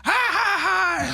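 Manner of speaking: high shrill mock laugh; hammed-up crazed laughing scream; manic screaming laugh. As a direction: cackle it wildly, way up high. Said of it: "And she's screaming".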